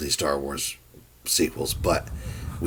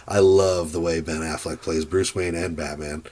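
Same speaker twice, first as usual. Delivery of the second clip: ordinary talk, nothing marked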